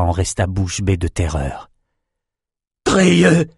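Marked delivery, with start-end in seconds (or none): 2.8-3.5 high, loud cry of horror; horrified cry. Acted. King cries out for his slain shaman, loud and high. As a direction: call out the name in shock. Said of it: "cria-t-il".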